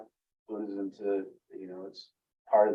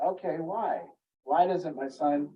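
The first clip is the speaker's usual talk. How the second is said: questioning; 6 semitones higher, slower than usual. Retold or imitated. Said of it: "the moment you go".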